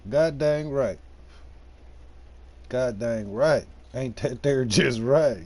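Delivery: hammed-up good ol' boy twang, stretched out. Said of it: "In my redneck voice".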